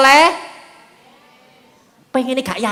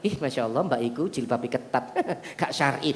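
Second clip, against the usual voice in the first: much lower, softer catty murmur with a laugh; gossiping critic; 11 semitones lower, 12 dB quieter than usual. Retold or imitated. Preacher mocks a woman judging another's hijab, catty low murmur. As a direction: sniff at her tight headscarf.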